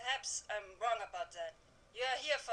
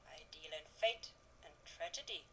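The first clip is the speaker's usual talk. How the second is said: low, hushed and breathy; a storyteller whispering of fate; ominous whisper; 6 semitones lower, 8 dB quieter than usual. Acted. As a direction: hiss it like a dark secret.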